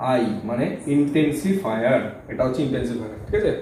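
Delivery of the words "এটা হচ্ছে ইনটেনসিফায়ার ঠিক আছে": ordinary talk, nothing marked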